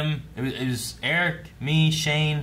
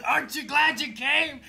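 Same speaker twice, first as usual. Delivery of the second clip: retold excited quote; 11 semitones higher than usual.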